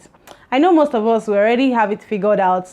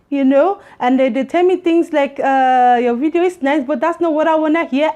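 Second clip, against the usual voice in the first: put-on whole monologue in a light, higher-pitched Indian accent; 5 semitones higher than usual. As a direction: keep the Indian accent going through the story. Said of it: "Indian accent".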